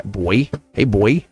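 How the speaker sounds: put-on playful Southern "boy"; Southern-accented greeting. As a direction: say it with a Southern twang. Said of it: "my Southern accent".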